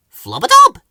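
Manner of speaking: very high, loud squeaky nonsense word; silly high flowerpot-man voice; Ben. A narrator's reading. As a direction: squeak it out, rising sharply. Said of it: "said Ben".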